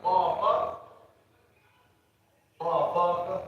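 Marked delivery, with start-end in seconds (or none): none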